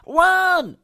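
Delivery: loud, high alarm yell; shout. A narrator's reading. Monkey shouting to the children to run. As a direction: scream the warning. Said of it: "Muggle Worm shouted".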